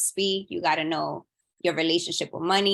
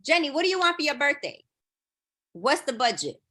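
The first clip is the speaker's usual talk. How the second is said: a higher, faster voice for the asker; higher, quick mock ask; 6 semitones higher than usual. The asker putting the birthday question to Jenny, pitched up. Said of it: "If you ask me".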